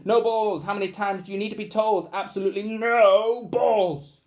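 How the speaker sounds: loud, high stern teacher rant. Acted. Teacher scolding the pupils, loud and stern. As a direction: lay down the law on balls. Teacher rants about banned balls.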